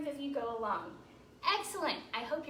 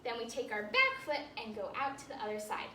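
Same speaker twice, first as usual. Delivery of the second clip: normal talking voice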